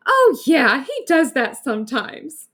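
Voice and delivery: loud, high voice through giggles; giggling. Acted. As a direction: say it laughing it off. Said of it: "her friend said between giggles".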